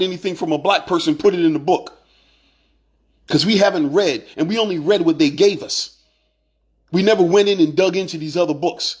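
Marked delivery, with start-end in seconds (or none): none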